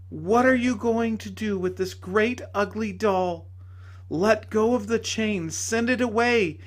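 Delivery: high, urgent pleading lady; lady's voice. Acted. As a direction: call him back to her.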